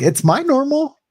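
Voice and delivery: high-pitched mock protest; retold defensive protest. Retold defence to his wife.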